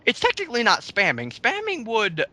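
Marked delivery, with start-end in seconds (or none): none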